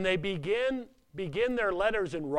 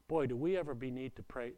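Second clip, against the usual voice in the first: ordinary talk, nothing marked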